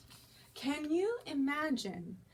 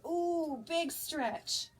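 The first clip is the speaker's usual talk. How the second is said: usual speaking voice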